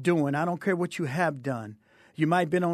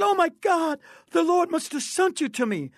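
much higher, astonished exclamation; amazed outcry; 10 semitones higher than usual. Retold or imitated. Woman realizes the Lord sent the driver, high amazed cry. Acted reaction of amazed passenger.

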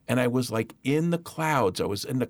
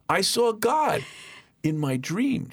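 put-on awed little boy; 7 semitones higher, slower than usual. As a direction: say it slowly with childlike awe. Child repeats news to mother.

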